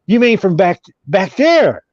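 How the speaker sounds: attitude change. Retold or imitated. Man realising who Ronnie is, pleasantly surprised. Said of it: "the guy's whole attitude changes".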